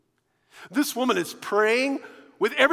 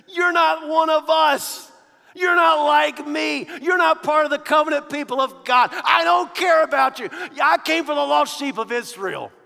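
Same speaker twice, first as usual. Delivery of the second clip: mock Jesus rejecting; 5 semitones higher, 5 dB louder than usual. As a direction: hammer each you're not home. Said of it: "Jesus just told her".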